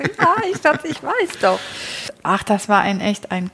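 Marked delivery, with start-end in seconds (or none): 0.0-1.6 knowing reply. Retold or imitated. Her own reply, retold.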